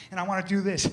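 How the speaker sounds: normal talking voice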